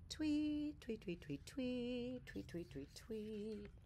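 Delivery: high, soft chirping tweets; birdsong tweets. A narrator's reading. Bird sings quietly beside Bear, soft high tweets. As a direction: chirp softly like a little bird. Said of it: "Bird began to sing quietly".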